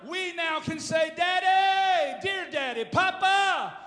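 high, loud, climbing sermon shout; full-force preaching. Acted. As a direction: preach it to the rafters. Preaching climax.

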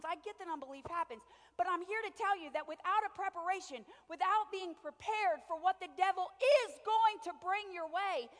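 preaching. Acted. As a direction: drive the point home to the church. Preaching builds to climax.

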